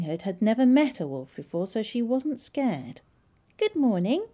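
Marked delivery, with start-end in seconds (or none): 3.6-4.3 high, polite little greeting; courteous girl's hello, pitched up. A narrator's reading. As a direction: answer politely in a raised storybook voice. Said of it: "she said politely".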